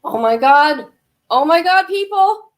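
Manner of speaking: loud, high stunned outcry; real shock. Real, not acted. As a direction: blurt it out in sheer disbelief. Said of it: "I'm, I'm just in shock".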